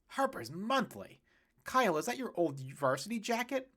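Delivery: dad startled by the varsity jacket; surprised exclamation. Acted. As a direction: gasp at the old jacket.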